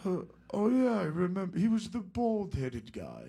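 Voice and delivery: hammed-up confused rocker remembering. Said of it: "And he goes".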